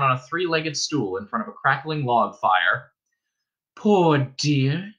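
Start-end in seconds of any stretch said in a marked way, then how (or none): 3.7-4.9 higher, slow, tender sigh of pity; pitying old woman. Acted. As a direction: feel sorry for the soaked girl. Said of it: "said the innkeeper's wife".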